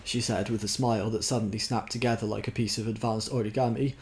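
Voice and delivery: plain reading voice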